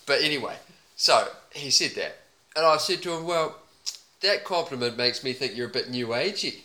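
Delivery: a hint of a laconic Aussie bloke accent; deadpan Aussie accent, mostly his own voice; put-on Aussie accent. Podcaster riffing about a stranger in a light put-on Aussie accent. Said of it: "I've already got my accent".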